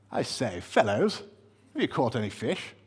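plummy upper-class English gent, lightly put on; a toff calling out to the boats, posh English accent. Jesus teasing the fishermen in a posh English accent. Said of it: "makes Jesus sound like a really posh Englishman".

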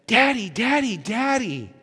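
put-on child calling dad with delight; eager child. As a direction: call out like a child running to dad.

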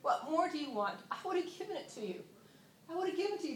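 high, pleading God voice with a laugh in it; voicing God, pleading. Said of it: "He said".

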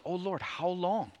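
slow, soft, drawn-out lament; a weary plea to God, held back; pleading cry. Retold or imitated. Prophet calling out to God over his nation, slow soft lament. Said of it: "and he calls out".

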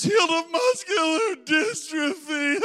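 loud, high, hammed-up sobbing wail; mock crying. Preacher retells talking to a lady about his healed aunt, mock crying. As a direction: wail it out like a bawling baby. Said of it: "I started crying like a baby".

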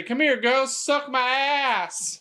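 high, drawn-out call to a ghost; mock ghost taunt. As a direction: holler it out, stretching the last words. Acted taunt calling a ghost.

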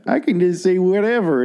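high, sweet Minnesota accent, like a nice churchgoer; friendly Minnesota accent. Shown as a demonstration. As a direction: lift "I can just say whatever" up into a chirpy Minnesota lilt. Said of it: "I have to do the Minnesota accent".

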